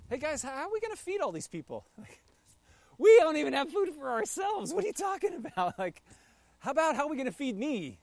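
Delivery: hammed-up indignant disciple; incredulous disciple. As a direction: scoff at an impossible request. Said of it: "then he goes".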